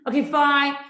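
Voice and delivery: high, loud fed-up huff; exasperated kid. Retold or imitated. Daughter giving in to mom, loud exasperated huff. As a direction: give in with a huff.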